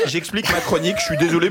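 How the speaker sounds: ordinary talk, nothing marked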